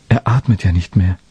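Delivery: plain reading voice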